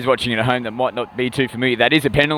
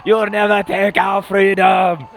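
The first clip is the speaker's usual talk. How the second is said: slow, high battle-cry shout in a hammed-up Scottish hero voice; bad Mel Gibson impression; 7 semitones higher, slower than usual. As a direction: roar it like a movie hero rallying an army, badly. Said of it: "the worst Mel Gibson accent and, uh, impersonation".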